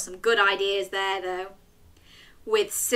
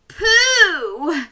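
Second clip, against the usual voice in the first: disgusted cough; 9 semitones higher, 10 dB louder than usual. Acted. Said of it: "Chloe coughed".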